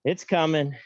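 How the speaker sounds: ordinary talk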